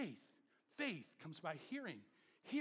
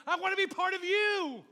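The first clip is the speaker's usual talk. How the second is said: emphatic cry; 11 semitones higher, 14 dB louder than usual. Acted. Preacher voices a drawn heart.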